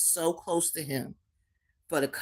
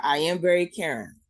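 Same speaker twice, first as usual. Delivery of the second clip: ordinary talk, nothing marked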